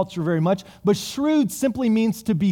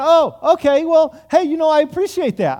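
higher, louder sly manager voice; smug manager; 7 semitones higher, 5 dB louder than usual. Acted. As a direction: play the cagey manager, pleased with himself. Manager responds to grateful client.

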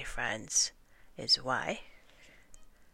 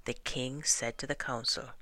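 ordinary delivery, nothing marked

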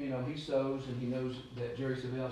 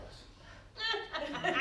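laughing. Real, not acted. Retelling a funny quote.

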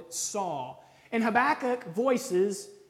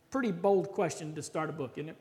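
usual speaking voice

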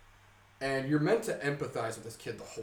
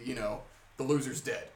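normal talking voice

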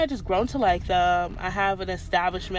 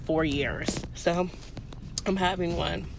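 ordinary delivery

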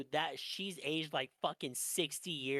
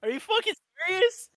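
much higher, loud shocked outburst; shocked disbelief; 10 dB louder than usual. Real, not acted. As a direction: blurt it out stunned. Shocked at the news.

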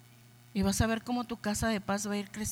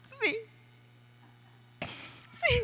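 a crying, high, breaking voice; tearful; 12 semitones higher, 4 dB quieter than usual. Retold or imitated. Wife tearfully accepting the pastor's hug, voice breaking high. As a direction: let the voice crack into tears.

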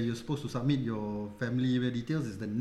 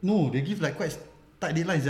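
normal talking voice

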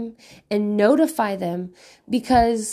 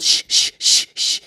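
hushing. Retold or imitated. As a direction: shush a fussy baby. Hushing baby.

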